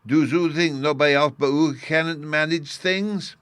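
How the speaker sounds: put-on high, loud small boy; little boy voice. A narrator's reading. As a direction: argue like an indignant little boy. Bruno argues.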